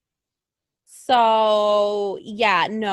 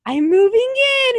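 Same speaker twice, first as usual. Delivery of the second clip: much higher, louder cheerful claim; cheerfully barge in uninvited; high chirpy announcement; 13 semitones higher, 6 dB louder than usual. Pretend. Alexa telling Bray she's moving in, high and loud. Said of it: "crazy ex-girlfriend who's like".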